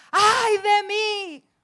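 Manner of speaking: high, loud, slow drawn-out wail; anguished cry. Shown as a demonstration. Prophet crying out before God's throne, a loud slow wail. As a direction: cry it out in dread, stretching every word. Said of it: "Está ahí con exclamación".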